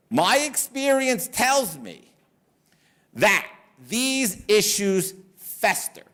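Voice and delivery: slow, spaced-out quote; measured letter reading. Retold or imitated. Lawyer writing a warning to his client, slow and measured. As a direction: lay out each phrase slowly, with pauses.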